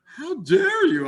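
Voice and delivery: laughing, high-pitched mock indignation; hammed-up rude Superman, laughing through it; mock outrage with a laugh. Professor reading rude Superman to a baby-contest lady, laughing. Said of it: "he tells the lady".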